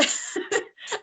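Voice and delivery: quick unvoiced laugh at herself; soft embarrassed chuckle; giggling at her own slip; breathy laugh. Real, not acted.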